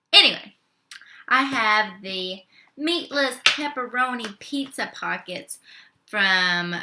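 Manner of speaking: normal talking voice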